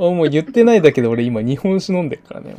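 usual speaking voice

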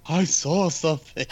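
high, drawn-out wail; mock-hysterical slow cry; acted panicked answer. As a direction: imitate a spooked friend's excuse.

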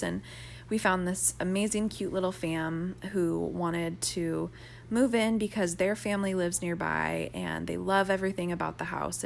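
ordinary talk

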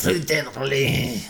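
put-on slow, angry French accent. Cohost's dare answered in an angry French accent. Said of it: "in an angry French accent".

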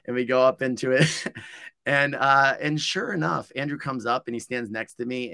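normal talking voice

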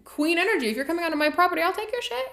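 high, sassy diva tone; high, campy, self-satisfied; sassy queen voice. Real, not acted. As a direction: play the proud queen guarding her turf. Sassy approving aside.

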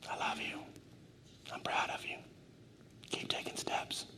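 soft, voiceless words of encouragement; whispering. Acted. Preacher as God telling a listener "you're my favorite", whispering. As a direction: whisper each short line, leaving space between. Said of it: "This is what God's whispering".

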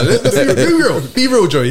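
high, loud teasing challenge; playful protest. Real, not acted. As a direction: call your friend out, pitched right up. Banter challenging a friend's story.

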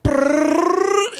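high rolled trill; long trilled rattle for cracking joints; imitated neck cracking. Man showing how his neck adjustment sounded, long high trill.

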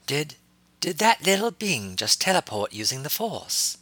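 slow stunned question. A narrator's reading. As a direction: ask it slowly, amazed. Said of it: "asked Shock Tai slowly".

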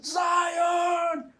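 high, loud call; shouting a name. Acted. Player calls Zion through the forest, shouting. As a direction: holler it out to someone far off. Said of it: "Tô gritando".

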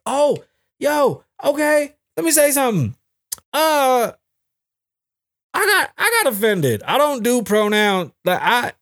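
high mock-offended squeal; high, squeaky fake outrage. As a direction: play it as a pretend outraged voice way up high.

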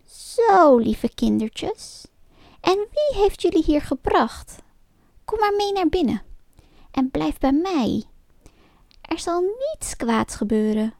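put-on high, honeyed old witch voice; high, sugary witch voice coaxing the children inside; sweet-talking witch. A narrator's reading. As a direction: lure the children in like a fake-kind witch.

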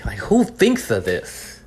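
drawn-out, incredulous question; slow disbelief. Real, not acted. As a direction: let the disbelief stretch each word. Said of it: "'cause I'm so angry".

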